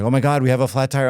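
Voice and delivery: loud, high, fast panicked cry; screaming panic. Retold or imitated. Parent panicking to the kid over a flat tire, shrill alarmed outburst. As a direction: blurt it out in a rush of alarm. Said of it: "you start screaming".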